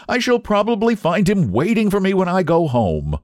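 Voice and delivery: high-pitched, lively protest; exclaimed cry. A narrator's reading. Young man exclaiming to his painter friend, high lively cry. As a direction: exclaim it high, in mock dismay. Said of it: "cried Huey".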